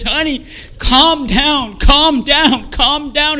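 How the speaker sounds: high, very loud pleading yell; screaming wife. Retold or imitated. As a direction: imitate a wife desperately shouting over a frenzy. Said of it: "my wife screaming".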